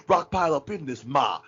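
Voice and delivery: high, loud mock gangster swagger; imitating J-Rock. J-Rock boasts as he jumps out, high mock swagger.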